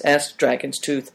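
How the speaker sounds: plain reading voice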